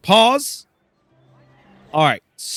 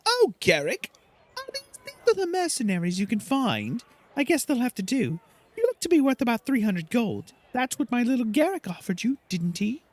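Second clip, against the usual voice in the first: higher, snooty diva voice; haughty bard Silke; 10 semitones higher, faster than usual. Acted. Silke sneering at the mercenaries, higher haughty voice. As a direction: look down your nose at the hired help. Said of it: "So Silke says".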